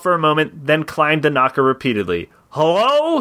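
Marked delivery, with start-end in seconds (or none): 2.5-3.2 high, hammed-up call; mock shout, higher; shouted greeting. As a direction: call out at a closed door, pitched up. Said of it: "he shouted".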